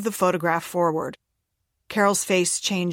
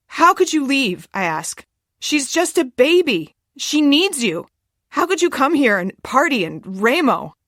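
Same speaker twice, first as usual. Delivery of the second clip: distraught accusation; 6 semitones higher, 4 dB louder than usual. A narrator's reading. Daughter accuses mother, distraught.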